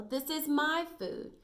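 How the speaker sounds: high, slow, possessive dog; Bella. Acted. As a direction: claim the food, stretching the words. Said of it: "said Bella".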